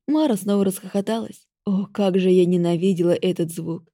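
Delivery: ordinary delivery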